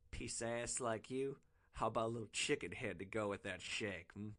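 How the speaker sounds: a crude john laying on the charm. Acted. Rider propositions the hooker.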